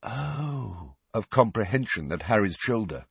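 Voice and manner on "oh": soft dawning oh. A narrator's reading. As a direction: breathe it softly, understanding. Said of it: "gave a soft".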